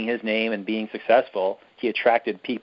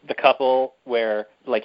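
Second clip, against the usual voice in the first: ordinary talk, nothing marked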